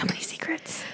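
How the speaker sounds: very soft whispered echo; mock secretive whisper. Co-host echoing the secrets joke, mock secretive whisper. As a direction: whisper back, playfully conspiratorial.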